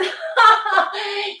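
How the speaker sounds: high sung giggle. Real, not acted. Teacher jokes about men at the gym, giggling. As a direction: let a playful giggle out.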